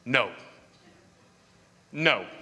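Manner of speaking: low, terse, frustrated no; frustrated refusal. Acted. Fishermen who caught nothing all night answering, frustrated. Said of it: "can you hear the frustration?".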